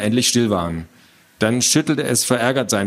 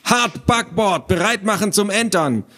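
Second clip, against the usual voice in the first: pirate command; 9 semitones higher than usual. Acted. Boy commanding his imaginary crew to board, pirate command. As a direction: order the crew like a playing child. Child playing pirate gives orders.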